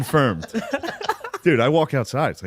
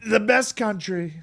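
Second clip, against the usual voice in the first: slow throaty Trump; Trump impression; 8 semitones higher, slower than usual. Shown as a demonstration. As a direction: Trump rally delivery. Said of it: "the easiest way to do Trump is".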